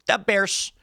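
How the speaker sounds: SNL-style fake Chicago accent; mock Chicago accent. Comedy sketch catchphrase, in a mock Chicago accent. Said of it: "S N L did that whole".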